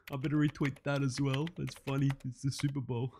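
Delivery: low, soft aside; amused note to self; muttering to himself. Acted. Talking to himself while retweeting.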